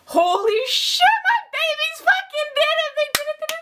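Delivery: high squealing joy; overjoyed. Real, not acted. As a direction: shriek it out like your team just won. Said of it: "I started losing my voice".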